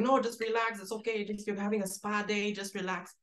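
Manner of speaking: high-pitched, pleading director telling actors to calm down; put-on placating voice, well above normal pitch; Fernando. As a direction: imitate a director insisting all is fine. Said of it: "And then he's like".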